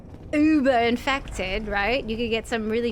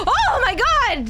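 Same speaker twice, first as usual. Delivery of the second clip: high, loud, slow shocked cry; real startled outburst; shocked exclamation; 11 semitones higher, 6 dB louder, slower than usual. Real, not acted. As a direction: cry out in stunned amazement. Sudden shock in game.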